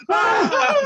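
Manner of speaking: sudden shrill-pitched yelp of fright; playful mock scream; high mock shriek. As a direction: shriek high like you're scared.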